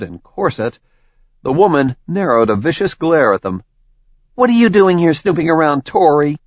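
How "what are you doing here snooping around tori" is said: higher, louder, fast hostile challenge; angry demand. Acted. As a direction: snap at the intruder. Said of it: "the woman's angry tone".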